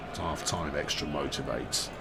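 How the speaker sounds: ordinary delivery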